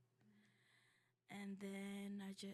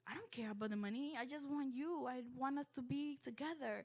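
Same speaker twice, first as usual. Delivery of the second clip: louder, rising plea to dad; retold upset pleading; 5 semitones higher, 5 dB louder than usual. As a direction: replay a child begging to stay together.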